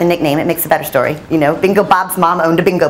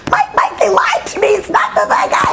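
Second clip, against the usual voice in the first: high, frantic, half-shouted plea; outraged disbelief; 13 semitones higher than usual. Retold or imitated. Reporter telling her editor on the phone she was lied to, frantic half-shout. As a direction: sound like you just got fooled.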